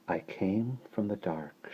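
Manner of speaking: put-on slow, hushed baby voice; dreamy, very soft chant; soft recital. A narrator's reading. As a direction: recite it softly and slowly. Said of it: "she recited softly".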